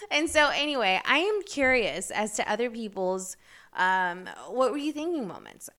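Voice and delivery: ordinary talk, nothing marked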